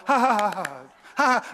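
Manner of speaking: a flat studio laugh track, ha ha ha; mock canned laugh. Preacher mocking the sitcom laugh track, canned laughter. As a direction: mimic the same canned laugh every time. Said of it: "clapping is pre-recorded".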